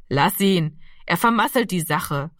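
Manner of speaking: usual speaking voice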